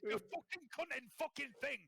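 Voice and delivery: high-pitched Sunderland cursing; hammed-up Sunderland granddad in a strop; Sunderland accent. Shown as a demonstration. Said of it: "That was my granddad".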